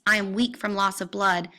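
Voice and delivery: usual speaking voice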